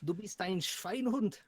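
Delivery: mock-German telling-off, drawn out; German insult. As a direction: relish each word of the German insult. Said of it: "I love that word".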